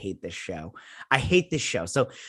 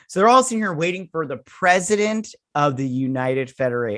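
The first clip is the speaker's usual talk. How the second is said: normal talking voice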